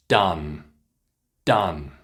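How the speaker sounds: "done" kept short with an open ah; short ah sound. Shown as a demonstration. Teacher repeating "done" for learners. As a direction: clip the vowel in "done". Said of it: "Keep it short".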